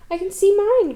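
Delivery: high, loud little Roo crying out; eager young kangaroo shouting; excited cry. A narrator's reading. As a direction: call it out thrilled, up high. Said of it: "cried Roo".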